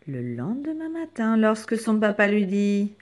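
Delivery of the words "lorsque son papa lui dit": ordinary delivery, nothing marked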